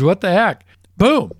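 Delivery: a hammed-up, gleeful win; reckless gamble. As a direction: shout it like you just won big.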